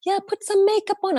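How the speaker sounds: high, sing-song mock of the friends; light mocking friend voice; friends teasing. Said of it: "And my friends were like".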